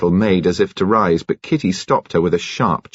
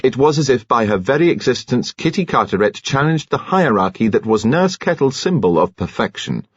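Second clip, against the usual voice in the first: ordinary delivery, nothing marked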